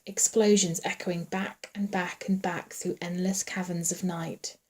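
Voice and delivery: ordinary delivery, nothing marked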